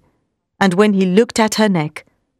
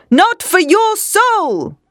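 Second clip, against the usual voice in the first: slow, high, raised cry, NOT hit hard; cried out; 12 semitones higher, much slower than usual. A narrator's reading. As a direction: hurl it at him to save him. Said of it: "he cried".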